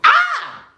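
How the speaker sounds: sudden high-pitched comic scream; mock scream from a review; acted scream. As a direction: cry out in a short, very high shriek. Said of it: "so fast that I screamed".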